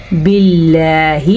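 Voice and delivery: باللہ with a light "l" and long held vowel; thin lam. Shown as a demonstration. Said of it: "باریک پڑھا جائے گا، جیسا کہ ہم اسے پڑھیں گے".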